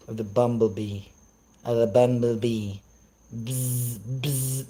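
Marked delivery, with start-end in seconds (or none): 3.3-4.7 mock buzzing. Mocking bumblebee creator.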